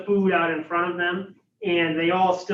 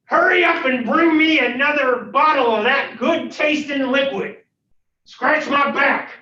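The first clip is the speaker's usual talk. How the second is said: loud, raised, demanding bellow; mean monster voice; 9 semitones higher, 8 dB louder than usual. Acted. Unseen creature ordering a servant about, mean bellow. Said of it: "another kind of mean sounding voice".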